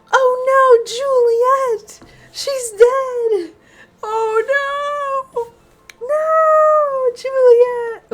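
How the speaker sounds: hammed-up high, loud wailing; upset wailing. Felipa fakes grief over her cousin, hammed-up high wailing. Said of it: "Perhaps a bit louder, like you're upset".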